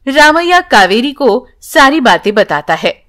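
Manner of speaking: ordinary delivery, nothing marked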